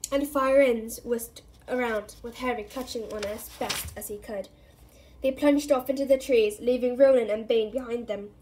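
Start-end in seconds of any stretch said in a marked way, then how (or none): none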